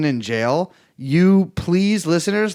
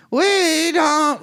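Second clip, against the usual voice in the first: high wavering mock Kamala voice; Kamala warble; 12 semitones higher, 3 dB louder than usual. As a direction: wobble the voice up high like her.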